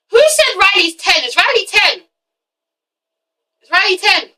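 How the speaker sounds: incredulous. Real, not acted. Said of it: "incredulous questioning".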